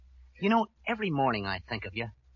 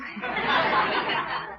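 nervous laugh; 3 dB louder than usual. Acted. Laughs after self-deprecation.

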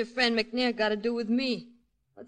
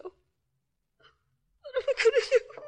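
breaking into tears; 11 semitones higher, much slower than usual. Acted. Wife hearing her husband is captured, crying. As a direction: voice cracking, slow and high on the last question. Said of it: "Go ahead, [name]. Cry".

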